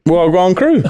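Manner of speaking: lightly done Jamaican greeting; Jamaican accent. Acted. Podcast host echoing Jamaican banter, light Jamaican accent. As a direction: give a playful, half-laughed Jamaican greeting. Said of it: "you was doing Jamaican before we started".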